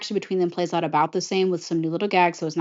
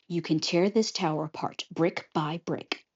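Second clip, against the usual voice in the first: lightly acted quiet menace; hardball threat; 8 dB quieter than usual. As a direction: drop your voice and play hardball. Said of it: "Rapunzel going hardball".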